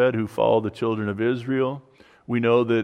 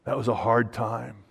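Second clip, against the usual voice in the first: hushed prayer; 4 semitones lower, 9 dB quieter than usual. Retold or imitated. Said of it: "where we say".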